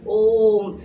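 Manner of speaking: ओम held for three beats, loud and stretched; long drawn Om. Shown as a demonstration. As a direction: chant ओम long, like a prayer. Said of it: "इतना लंबा बोला जाता है". (ओम को तीन मात्रा तक खींचकर, ज़ोर से)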